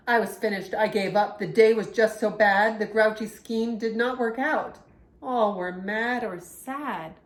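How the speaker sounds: lively, expressive picture-book reading; reading to children. A narrator's reading. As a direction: read the story to young kids with feeling. Reads picture book aloud.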